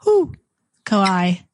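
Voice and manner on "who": high owl "who"; playful high-pitched owl call; owl hoot. Pretend. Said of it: "She's making the owl noise".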